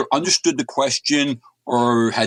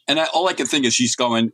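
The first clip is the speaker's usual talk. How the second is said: ordinary delivery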